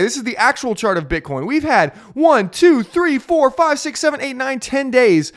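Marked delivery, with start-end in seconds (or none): 1.5-5.3 excited counting. Real, not acted.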